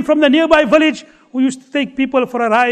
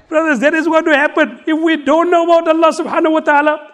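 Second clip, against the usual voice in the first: normal talking voice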